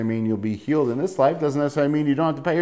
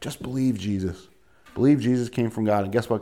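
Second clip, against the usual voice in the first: ordinary talk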